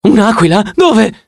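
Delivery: high, frightened shriek; panicked shriek. Acted. Ganymede hiding under the table, shrieking high in panic. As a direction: yelp it while diving for cover. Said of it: "strillò Ganimede".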